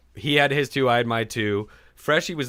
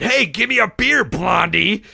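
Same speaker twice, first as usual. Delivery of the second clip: loud, higher, brash bar order; man trying to get the bartender's attention, loud and brash; bossy demand; 7 semitones higher, 6 dB louder, slower than usual. Retold or imitated. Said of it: "And I said".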